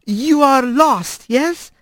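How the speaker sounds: gentle halting. Acted. Careful halting foreign speech.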